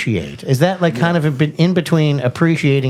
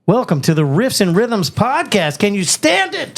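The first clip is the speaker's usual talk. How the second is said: hyped intro; 7 semitones higher than usual. Acted.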